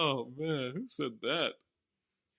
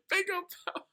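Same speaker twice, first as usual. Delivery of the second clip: laughing; 4 dB quieter, faster than usual. Real, not acted.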